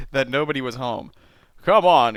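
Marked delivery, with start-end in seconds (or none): 1.6-2.2 loud, higher call; raised-voice urging. Acted. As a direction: call it out sharply to a companion. Calling out to companion.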